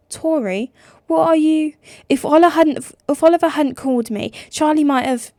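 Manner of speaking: high, loud furious shouting; yelling. Acted. Sister yelling at Nick over the phone, furious. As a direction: scream the accusation.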